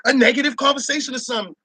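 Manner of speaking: ordinary talk, nothing marked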